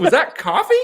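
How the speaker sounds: high, loud, laughing mock outrage; retold horrified disbelief. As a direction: shriek the question through laughter. Retold horror, breaking into laughter.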